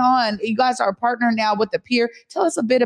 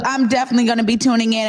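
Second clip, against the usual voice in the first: ordinary talk, nothing marked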